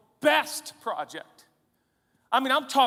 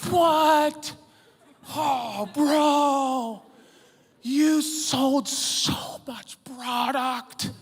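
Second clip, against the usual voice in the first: awed and slow, ending in a shout; mock amazed culture; 5 semitones higher, 4 dB louder, much slower than usual. Preacher mocking the culture's awe at church success. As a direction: gasp in mock wonder. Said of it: "the whole culture's gonna go".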